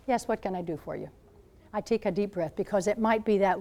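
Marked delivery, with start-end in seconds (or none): 0.1-1.1 softer, kindly "how can I help"; polite and gentle. Retold or imitated. Nun answering a stranger who stopped her, softer and kind. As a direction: swallow the grumble and answer sweetly.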